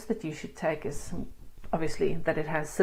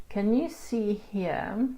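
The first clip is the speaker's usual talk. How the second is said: ordinary delivery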